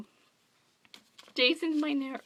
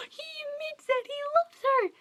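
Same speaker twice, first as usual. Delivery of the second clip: thrilled squeal; 14 semitones higher than usual. Retold or imitated. Reader gasps at Leo's confession.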